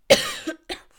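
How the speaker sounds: loud harsh cough; coughing fit. Real, not acted. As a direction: cough hard mid-sentence. Coughing again.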